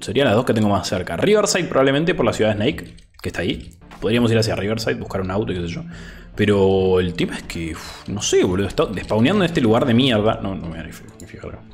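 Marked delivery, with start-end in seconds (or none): none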